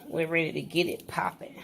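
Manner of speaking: breathy excited whisper. Real, not acted. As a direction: whisper it like a secret. Hyped whisper before cooking.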